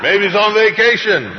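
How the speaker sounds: high, loud mocking taunt; sarcastic high-pitched shout; shouted sarcasm. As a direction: yell the jibe across to the crowd. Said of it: "sarcasm and cynicism in this".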